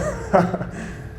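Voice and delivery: quiet unvoiced chuckle; soft breathy laugh. Real, not acted. Laughs after the line.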